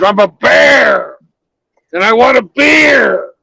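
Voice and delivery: high, loud mock bear demand; hammed-up bear yelling for a beer; shouting bear. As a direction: bellow it like a cranky bear.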